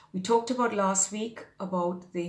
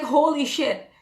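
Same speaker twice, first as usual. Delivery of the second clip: high, loud exclamation of wonder; acting out a stunned revelation; retold amazement; 6 semitones higher, 6 dB louder than usual. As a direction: blurt it out, re-enacting the shock. Retelling her amazed reaction.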